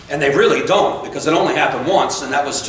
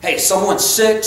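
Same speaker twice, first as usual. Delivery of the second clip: ordinary delivery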